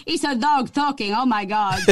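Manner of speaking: amused imitation of mum in a Portuguese accent; tickled Portuguese-accented exclamation; mum's Portuguese accent. Mum amazed by the cartoon, Portuguese accent. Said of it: "She was like".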